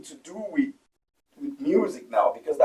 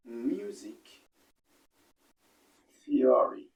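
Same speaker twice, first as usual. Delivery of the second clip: whispered; 4 semitones lower, much slower than usual. Acted. Dramatic whispered title.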